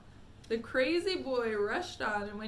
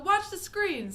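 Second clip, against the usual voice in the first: urgent; 9 semitones higher, 8 dB louder than usual. Acted. Wonka urgent at the screen.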